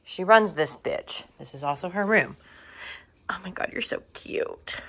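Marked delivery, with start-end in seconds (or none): 3.3-4.8 soft cooing whisper; whisper to cat. Real, not acted. Owner cooing over her cat Willow in a whisper. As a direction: breathe it out to the cat, all doting.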